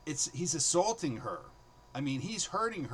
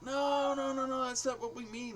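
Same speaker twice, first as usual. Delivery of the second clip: a class jumping in to correct him, pitched way up; protesting students; 11 semitones higher than usual. Retold or imitated. Students correct teacher's joke.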